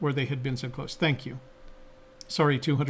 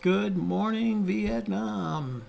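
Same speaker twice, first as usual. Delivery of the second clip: Good Morning Vietnam; 5 semitones higher, much slower than usual. Retold or imitated. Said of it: "like Robin Williams".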